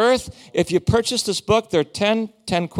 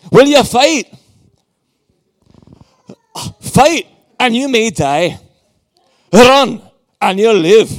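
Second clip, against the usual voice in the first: loud, raised-pitch war leader's oratory; Braveheart battle speech; 3 semitones higher, 7 dB louder than usual. Acted. Viking preacher performs Braveheart for the congregation, loud rousing battle speech. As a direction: proclaim it to an army with big pauses. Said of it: "Braveheart? Come on, do you know Braveheart".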